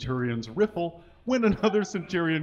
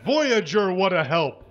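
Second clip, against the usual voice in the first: grunt; 4 semitones higher, 9 dB louder than usual. Acted. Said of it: "grunts another".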